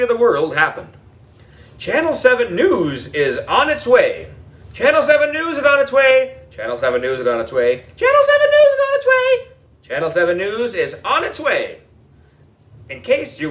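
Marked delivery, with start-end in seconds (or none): 1.8-11.8 each take in a new voice, one squealed very high; excited kids repeating. A narrator's reading. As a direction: pass the news around like excited kids.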